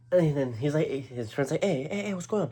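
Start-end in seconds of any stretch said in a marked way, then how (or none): 1.6-2.5 high-pitched interjecting voice; imitated friend. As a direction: mimic a friend stepping in, pitched well up. Acting out the friend stepping in.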